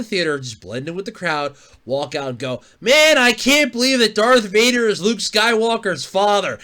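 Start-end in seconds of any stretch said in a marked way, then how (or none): 2.8-6.6 mock loud spoiler. Mock spoiling Vader loudly.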